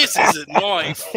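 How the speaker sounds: slow, loud Australian drawl, delivered with swagger; Crocodile Dundee impression. Shown as a demonstration. Finishing the Crocodile Dundee bit for friends, slow Australian drawl. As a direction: lay out the famous knife line in a lazy Australian accent. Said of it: "nice little Crocodile Dundee reference".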